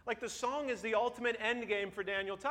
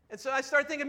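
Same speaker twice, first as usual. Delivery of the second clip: ordinary talk, nothing marked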